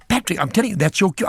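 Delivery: urgent insisting. Retold or imitated. Colleague insisting he's missing his cue, urgent.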